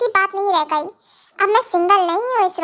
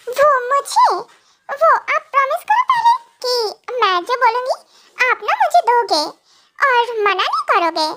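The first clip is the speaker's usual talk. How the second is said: higher, teasing wife setting conditions; playful young wife; 5 semitones higher than usual. Acted. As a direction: bargain sweetly, laughing. Wife demands a promise. (ऊँची, शरारती हँसती पत्नी)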